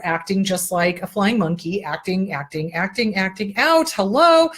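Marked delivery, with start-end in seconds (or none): 3.9-4.5 1 mock hello. Real, not acted. Sarcastic aside mid-rant.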